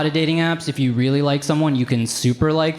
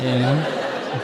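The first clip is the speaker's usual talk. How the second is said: ordinary talk, nothing marked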